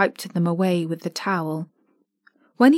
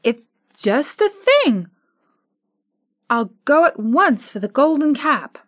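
put-on pleading homesick girl; high, longing child; higher little girl voice; 5 semitones higher, 3 dB louder than usual. Dorothy tells friends she longs for Kansas, pleading.

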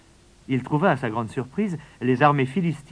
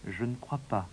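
much softer, calm and sure; calm boy; 10 dB quieter than usual. Acted. David answers the king calmly, much softer. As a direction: answer quietly, unshaken. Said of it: "fit calmement le garçon".

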